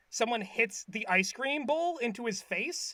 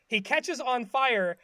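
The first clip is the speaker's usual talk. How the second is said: usual speaking voice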